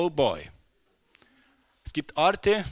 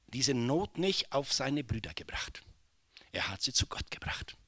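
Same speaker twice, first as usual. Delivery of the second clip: hushed sermon whisper; 6 dB quieter than usual. Acted. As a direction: drop to a whisper for the secret. Intimate point about Joseph's burden.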